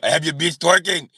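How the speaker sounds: ordinary talk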